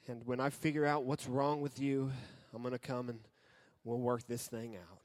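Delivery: soft, sighing letdown with long pauses; quietly acted weary disappointment, trailing low; disappointed dad. Father sends his child to the room, disappointed and hushed. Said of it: "loves making their dad go".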